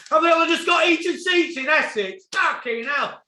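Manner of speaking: loud, high outraged question; incredulous outburst. Real, not acted. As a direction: throw it out in loud disbelief. Incredulous rant about heated seats.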